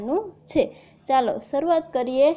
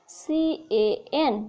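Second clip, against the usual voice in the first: slow spelling out; 3 semitones higher, slower than usual. Shown as a demonstration. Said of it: "એનું ઉચ્ચારણ થાય કેન".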